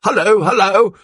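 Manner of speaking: high, loud hearty hello; booming cheery hello; cheery greeting. A narrator's reading. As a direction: greet them like an old pal. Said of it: "with a cheery".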